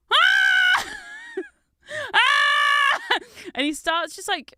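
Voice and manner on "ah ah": piercing high wailing scream; loud high child's crying shout; toddler scream. Retold or imitated. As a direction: scream like a furious little kid. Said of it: "the small child who's like".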